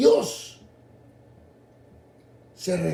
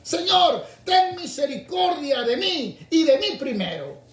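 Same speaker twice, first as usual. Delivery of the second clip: Señor pitched very high, louder; selfish plea; 8 semitones higher, 3 dB louder than usual. Retold or imitated. Preacher repeating the selfish prayer of many, higher plea. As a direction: cry Señor high, then stretch mí. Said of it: "hubiesen hecho lo mismo".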